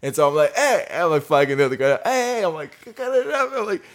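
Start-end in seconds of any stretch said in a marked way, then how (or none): 0.5-0.9 high shouted hey; calling out. Retold or imitated. Calling out to coworker. 2.0-2.4 calling out. Retold or imitated. Calling out to coworker. 3.0-3.5 calling out. Retold or imitated. Shouting over motor.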